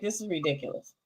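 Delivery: muttered disbelief. Retold or imitated. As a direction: drop it low and soft, unimpressed. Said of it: "I was like".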